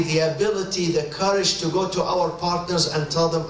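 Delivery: ordinary talk, nothing marked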